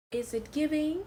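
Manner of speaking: high sassy question; sassy drag queen. Acted. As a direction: ask it with diva sass. Posing banter, first take.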